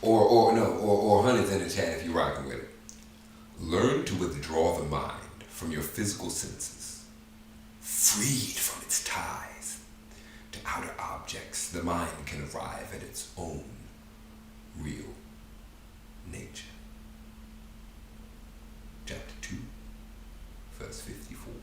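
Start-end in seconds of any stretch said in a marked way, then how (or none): 3.6-16.7 slow, fading into a whisper; mystic voice. Acted. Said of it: "the mystic voice going on". 19.1-21.5 soft whisper. Acted. Whispered verse reference.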